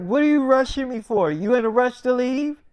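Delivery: mock cross questioning; irritated challenge. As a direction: confront him as the annoyed sick wife. Sick wife challenging husband.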